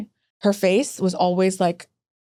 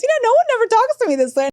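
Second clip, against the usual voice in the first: amused surveyor; 12 semitones higher, 3 dB louder, faster than usual. Retold or imitated. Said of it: "She goes".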